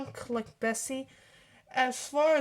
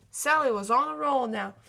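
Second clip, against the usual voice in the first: plain reading voice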